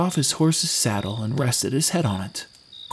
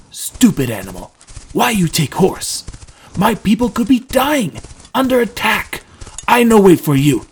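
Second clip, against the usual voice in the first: loud, irritable outburst; Trendon snapping back; 7 semitones higher, 6 dB louder than usual. A narrator's reading. Stressed rescuer refuses to wait for Drew, snapping loudly. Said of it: "snapped back Trendon".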